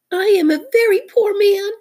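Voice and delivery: high, loud mock-tearful wail; sobbing. A narrator's reading. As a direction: wail pitifully as a small creature. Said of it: "he sobbed".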